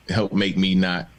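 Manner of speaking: normal talking voice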